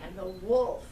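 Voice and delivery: usual speaking voice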